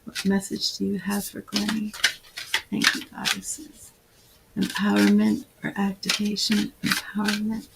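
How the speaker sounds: soft, reverent channeling voice; hushed invocation. Acted. Healer calling on goddesses for her client, hushed and reverent. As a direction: murmur a prayer to the goddesses.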